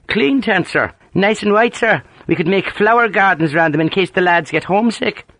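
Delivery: mock-earnest Ulster ribbing; amused Ulster accent. A narrator's reading. Sergeant teasing his grumbling officer, in amused Ulster accent. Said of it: "Harper's thick Ulster accent was rich with amusement".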